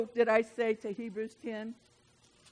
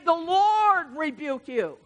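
slow, louder, solemn rebuke; God rebuking Satan; 8 semitones higher, 9 dB louder, much slower than usual. Acted. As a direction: pronounce it like God's judgment, slowly. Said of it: "And then he says it again".

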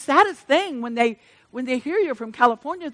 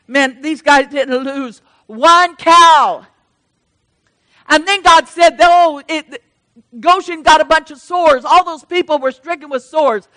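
preaching at full force; 3 semitones higher, 11 dB louder than usual. Acted. Preacher rousing the congregation, loud fervent preaching. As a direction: lift the church with a rising prayer.

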